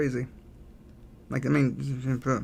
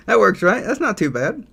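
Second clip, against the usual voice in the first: higher and louder, pleased and bouncy; excited; 8 semitones higher, 8 dB louder than usual. Real, not acted. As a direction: sound thrilled it turned out fine. Pleased with the drawing.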